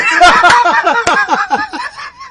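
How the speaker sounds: a sudden high cackle; high burst of laughter. Real, not acted. Laughing at a chat joke.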